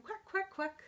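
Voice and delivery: high, short quacks; happy duckling quack. Acted. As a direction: quack lightly like a happy little duck. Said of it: "When I'm feeling happy, I say".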